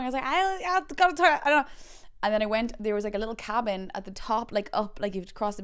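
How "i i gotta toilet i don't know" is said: high, loud, flustered stammer; mock panic. Fleeing surfer to instructor, high panicked blurt. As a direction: blurt it out in comic desperation.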